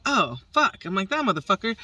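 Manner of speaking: high, loud "Oh, fuck"; shocked as it flies off; startled curse. Retold or imitated. Bird escaping her hand, high startled curse.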